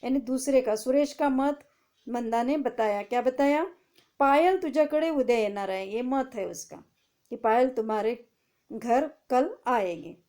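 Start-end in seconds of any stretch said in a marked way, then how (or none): 4.2-5.9 reading aloud. Shown as a demonstration. Teacher rereads the quoted sentence.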